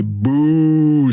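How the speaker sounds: long drawn-out "bouh"; jeering boo. Shown as a demonstration. Showing what you say to boo someone, long jeer. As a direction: boo like a disapproving crowd. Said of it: "quand tu veux huer quelqu'un".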